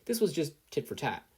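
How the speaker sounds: ordinary delivery, nothing marked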